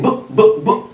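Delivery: unvoiced short B with no vowel; just touch B and leave it, no vowel; bare consonant B. Shown as a demonstration. Teacher drilling the class on a bare B. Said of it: "you simply say B".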